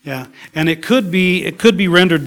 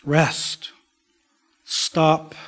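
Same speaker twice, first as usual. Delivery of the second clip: ordinary talk, nothing marked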